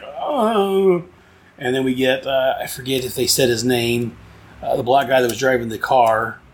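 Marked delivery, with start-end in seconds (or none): 0.0-1.0 high, drawn-out mock groan; hurt groan. Reviewer imitating the knight laying there hurt, mock groan. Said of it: "he's just laying like".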